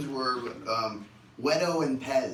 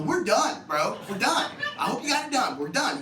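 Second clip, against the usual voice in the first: high, loud pleading in pain; mock agonized yell; pained plea; 10 semitones higher, 3 dB louder than usual. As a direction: beg him to stop. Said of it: "I go".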